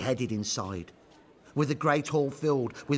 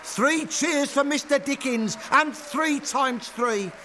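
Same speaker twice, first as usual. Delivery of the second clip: high, loud, slow rallying call; shouted cheer; 13 semitones higher, 5 dB louder, slower than usual. A narrator's reading. Working man leads cheers for Dickens in the hall, loud shout. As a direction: bellow it to the whole hall. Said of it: "a working man shouted out".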